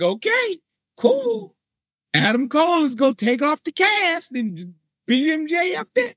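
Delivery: sarcastic high inner voice; mock hopeful. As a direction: pretend to still believe it's coming.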